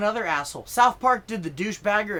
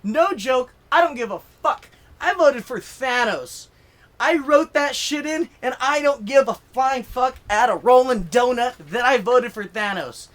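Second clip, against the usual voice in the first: fired-up, raised, emphatic rant; getting mad; 4 semitones higher, 5 dB louder than usual. Real, not acted. Comedian confessing his vote to the audience, fired up. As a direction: punch it out, defiant and worked up. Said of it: "I'm getting mad talking about it".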